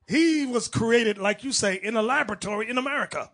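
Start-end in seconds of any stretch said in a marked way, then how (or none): none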